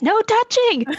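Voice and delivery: sharp high No touching; loud scold. Real, not acted. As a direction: snap it out loud. Playful scolding at table.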